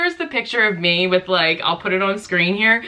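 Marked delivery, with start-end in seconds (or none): none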